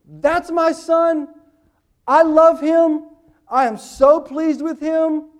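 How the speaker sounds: loud, high, slow proud shout; the Father shouting his pride in his son down to earth; the Father yelling. Acted. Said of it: "the Father just yells into earth".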